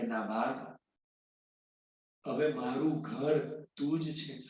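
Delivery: low, soft and slow, near tears; tearful confession. Acted. Old father tells his son he has no home, low tearful whisper. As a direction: let it break out quietly through tears. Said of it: "રડવા લાગ્યા".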